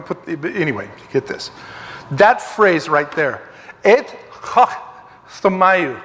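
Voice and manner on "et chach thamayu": "Et chach thamayu" with the ch as a throaty Hebrew chet; careful Hebrew reading of "Et chach thamayu"; Hebrew pronunciation. Shown as a demonstration. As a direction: sound out "chach" from the back of the throat, then "thamayu". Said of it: "This is how you would say that".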